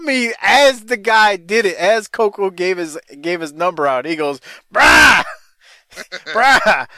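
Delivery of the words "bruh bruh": loud, high retold "bruh"; excited caller. Harper calls the host about what he heard, excited. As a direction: burst out laughing on an excited callout. Retelling an excited phone call.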